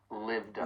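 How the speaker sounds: "lived a" run together as one word; "lived a" with no gap; linked words. Shown as a demonstration. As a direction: slide the D of "lived" into "a". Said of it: "it also occurs with the words".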